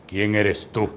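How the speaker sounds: imitated low, ominous question; very deep voice. Possessed man confronting the young preacher, very deep voice. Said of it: "me dice con una voz muy grave".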